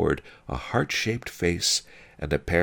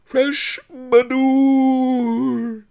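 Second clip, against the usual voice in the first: long, high street vendor's cry; quavering, melancholy shout; 7 dB louder, much slower than usual. A narrator's reading. Old peasant calls his wares, quavering melancholy shout. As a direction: wail it out over the street, drawn out. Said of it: "the old peasant cried".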